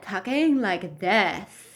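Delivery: put-on slow, drawn-out valley-girl whine; childish valley girl. Vlogger scolding a woman for sounding immature, mocking valley-girl drawl. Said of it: "You might wanna work on sounding a little bit more adult".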